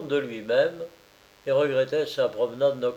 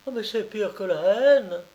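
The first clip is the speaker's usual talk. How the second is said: mild indignation; 8 semitones higher than usual. A narrator's reading. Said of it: "fortement indignée".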